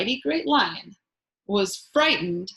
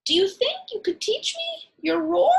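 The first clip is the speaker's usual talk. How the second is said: high, small, polite mouse voice; tiny mouse voice; 12 semitones higher than usual. Acted. The timid mouse wakes the sleeping lion in a high squeak. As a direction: speak up like a little mouse.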